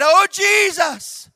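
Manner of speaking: slow, high, loud cry. Retold or imitated. Man in an ambulance calling on Jesus, drawn-out cry. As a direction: stretch the cry out at full voice. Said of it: "I just cried".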